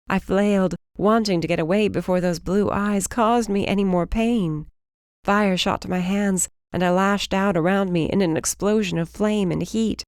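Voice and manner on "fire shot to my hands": ordinary delivery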